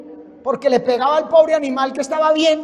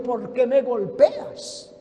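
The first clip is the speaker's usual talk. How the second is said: slow, softer plaintive question; donkey's reproach; 3 semitones lower, 6 dB quieter, much slower than usual. Acted. Little donkey who can't take more blows, slow soft reproach. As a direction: draw it out softly, as the wronged little donkey. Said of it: "el burrito no aguantó y volvió y dijo".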